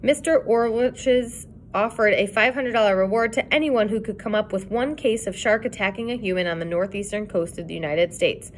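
plain reading voice

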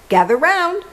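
high rallying call. A narrator's reading. Ladybug summons the animals, calling out high. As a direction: summon everyone with a lifted voice. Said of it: "were the second and third".

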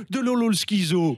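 ordinary talk, nothing marked

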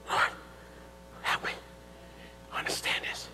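barely audible, whispered plea to God; whispering a private request; hushed prayer. Retold or imitated. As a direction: murmur it under your breath, pausing between words.

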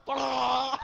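high imitated yell coming up for air; gasping yell. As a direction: surface with a high "ah!". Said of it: "he gets up like".

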